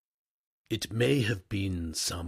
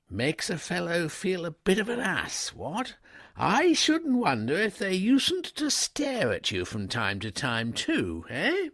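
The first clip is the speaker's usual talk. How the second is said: put-on higher, louder young gentleman; an easygoing young master stopping his valet; breezy upper-class gent, pitched up; young toff; 11 semitones higher, 6 dB louder than usual. A narrator's reading. Wooster uneasy about staring girls.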